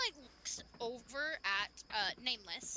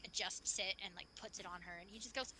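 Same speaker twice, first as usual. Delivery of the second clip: normal talking voice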